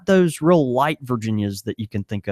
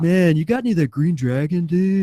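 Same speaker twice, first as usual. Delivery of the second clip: hippie voice; 5 semitones higher than usual. Retold or imitated. Customer asking for weed.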